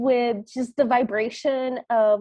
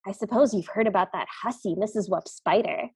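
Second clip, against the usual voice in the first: gossiping spider; 6 semitones lower than usual. Retold or imitated. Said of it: "like, whispers".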